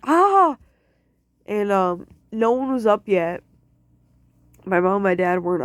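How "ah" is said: sharp pain yelp. Retold or imitated. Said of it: "I audibly went".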